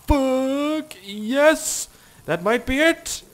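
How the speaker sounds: high, loud excited yell. Real, not acted. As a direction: shout it with sudden hope. Big jump looks promising.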